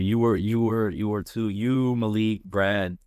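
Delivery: normal talking voice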